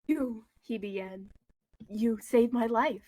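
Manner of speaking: usual speaking voice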